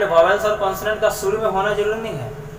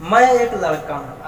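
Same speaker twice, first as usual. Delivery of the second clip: usual speaking voice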